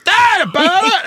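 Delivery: high-pitched imitation of a kid's yell; mock weird yelling. Imitating the kid circling the dinner table, weird high yelling. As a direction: yell a nonsense word, hammed-up and drawn out. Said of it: "Like, yelling a weird thing".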